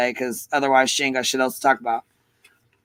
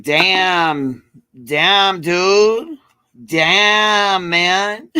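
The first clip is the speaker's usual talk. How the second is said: drawn-out impressed damns, pitched up; mock amazed damn; 5 semitones higher, 3 dB louder, much slower than usual. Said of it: "I'm like".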